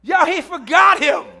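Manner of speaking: cracking up while preaching; laughing shout. Real, not acted. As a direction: shout it through real laughter. Preacher laughs at forgotten son.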